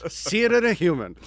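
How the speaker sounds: high, loud pitchman voice; hammed-up ad announcer. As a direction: sell it like a TV promo. Spoof ad pitch for the show.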